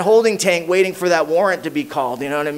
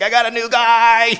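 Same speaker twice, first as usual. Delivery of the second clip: higher and louder, hammed-up delight; thrilled sponsor; 7 semitones higher, 5 dB louder than usual. Sponsor gleefully announcing his new guy, higher and louder. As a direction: play up the sponsor's glee. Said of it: "He's like".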